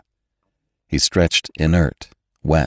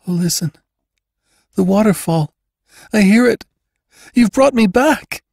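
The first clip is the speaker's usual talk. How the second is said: put-on very high girl's voice, building louder; high, broken exclamations growing in intensity; girl's mounting emotion; 3 dB louder than usual. A narrator's reading. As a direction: start halting, rise to a high cry. Girl realises she was brought back.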